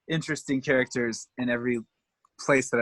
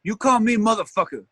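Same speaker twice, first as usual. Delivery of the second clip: higher, put-on old lady voice; mock scolding, pitched up; imitated gruff retort; 7 semitones higher than usual. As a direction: play a feisty old lady correcting you. Imitating old lady's retort to greeting.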